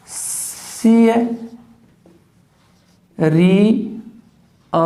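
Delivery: सी है as a drawn-out sia, then री and अ apart; syllable breakdown. Shown as a demonstration. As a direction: split it slowly into sia, ree, uh. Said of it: "ई है".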